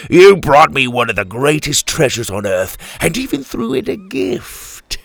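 loud, exultant pirate captain; triumphant, leaping high at the end; gloating delight. A narrator's reading. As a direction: crow over the prize. Captain gloats over his treasure.

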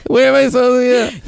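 very high, whiny put-on voice; helpless stripper. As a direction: whine like the lost girl. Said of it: "stripper again".

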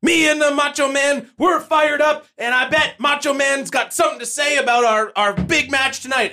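high hyped wrestler shout; Sting promo impression. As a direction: pump it up like Sting. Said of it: "When Sting comes in and he's like".

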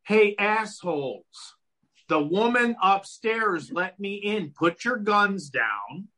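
high, slow, fed-up Jerry; with such conviction. Retold or imitated. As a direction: spell it out to the cops, slow and indignant. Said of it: "he probably said that with such conviction".

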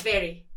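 crisp falling Glaswegian "Very"; sharp Glaswegian VERY. Shown as a demonstration. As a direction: say "Very" sharply, dropping at the end. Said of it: "It's sharper than you think".

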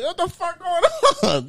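high-pitched mock outrage, laughing; indignant dad. Dad apprehended by two cops, imitated indignation. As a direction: imitate a bewildered father squawking in protest.

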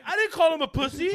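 very high, loud mock denial; protesting kid. Accused kid denies it to the coaches, mock outraged protest. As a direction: squeal it like an accused kid, half laughing.

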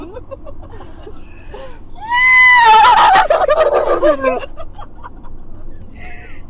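long loud whinnying horse sound; horse snort. Acted. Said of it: "It sounds a lot like a horse".

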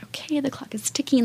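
breathy whisper, no voice. Retold or imitated. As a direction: whisper it under your breath. Retold inner worry, deadline.

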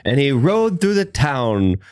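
storyteller voice. Acted. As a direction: recite it like a schoolbook legend. Mock reading of legend.

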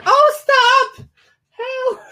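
very high, squealing mock moan.

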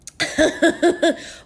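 light high laugh; giggling at herself; high giggle. Real, not acted. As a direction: giggle at the idea. Laughs about visiting London.

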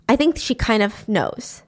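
ordinary delivery

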